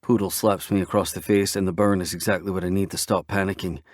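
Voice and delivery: ordinary delivery, nothing marked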